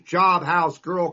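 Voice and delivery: ordinary talk, nothing marked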